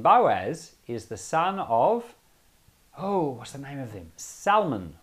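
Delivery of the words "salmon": Salmon said with a clear L, SAL-mon; l pronounced. Shown as a demonstration. Naming Boaz's father to the group, L sounded. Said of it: "I think salmon is a fish".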